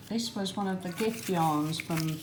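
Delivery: ordinary talk, nothing marked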